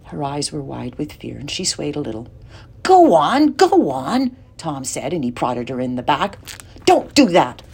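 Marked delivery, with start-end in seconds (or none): 2.8-4.4 high, loud urging push. A narrator's reading. Tom urging his sister toward the door, loud and pushy. 6.9-7.6 loud sharp snap; snapped. A narrator's reading. Lindy snapping at Tom for prodding her, loud and sharp. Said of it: "she snapped".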